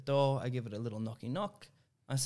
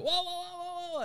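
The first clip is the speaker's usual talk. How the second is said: much higher, startled owner whoas; owner's startled whoas. Retold or imitated. Owner rushing in on his dog, high startled whoas. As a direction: squeak out the owner's alarm.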